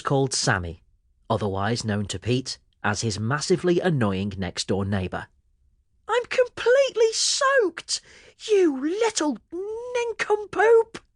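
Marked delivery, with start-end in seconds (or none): 6.1-11.0 put-on very high, slow, indignant boy's voice; cross yell. A narrator's reading. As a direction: tell him off, outraged. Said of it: "Pete yelled".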